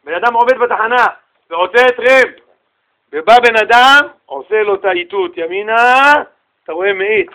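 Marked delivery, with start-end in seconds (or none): none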